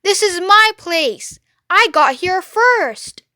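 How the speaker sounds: high, loud rat voice, slow at the end; high rat voice, possessive; stubborn rat. Acted. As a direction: insist like a squeaky landlord. Rat claiming his place.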